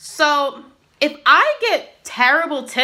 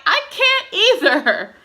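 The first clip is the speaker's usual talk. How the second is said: higher and slow, through a laugh; laughing reply; 9 semitones higher, much slower than usual. Real, not acted. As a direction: laugh it out in disbelief.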